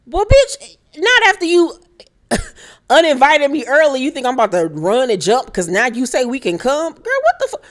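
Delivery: outraged sassy retort, laughing mid-line; sassy clapback. Pretend. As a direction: fire back at her like she's in the room. Answering Duffy's snub herself.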